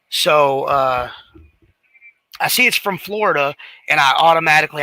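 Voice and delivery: usual speaking voice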